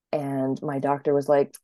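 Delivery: ordinary delivery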